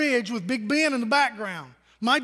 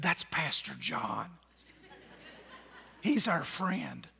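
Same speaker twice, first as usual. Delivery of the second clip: put-on low, slow, hushed child's whisper; little girl whispering; 6 semitones lower, 9 dB quieter, slower than usual. Pastor imitating a preschool girl whispering to a boy, hushed. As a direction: breathe it like a shy little girl. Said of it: "whisper to the little boy".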